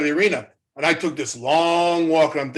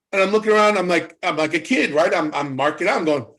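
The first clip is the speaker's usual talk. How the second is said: ordinary talk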